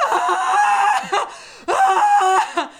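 long, high-pitched wailing screams; loud hammed-up crying shrieks; mock scream-crying wail. Re-enacting scream crying at a TV show, hammed-up wail. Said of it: "started scream crying".

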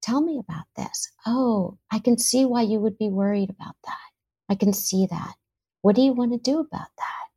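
soft, hushed and gentle, a near whisper at times; sit with them and soothe softly; calm empathic listening. Shown as a demonstration. Parent listening to a worried child, soft and soothing. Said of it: "You're there to say".

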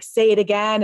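normal talking voice

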